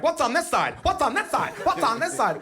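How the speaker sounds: put-on high, curious little boy; hammed-up kid hopping side to side; son's voice. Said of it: "he's like".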